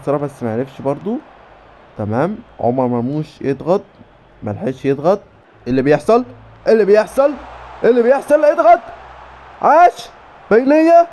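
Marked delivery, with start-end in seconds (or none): 5.7-11.1 mounting excitement. Real, not acted. Gamer urging a press and through ball, mounting excitement. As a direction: climb higher with each repeat into loud calls. Urging players as a chance builds.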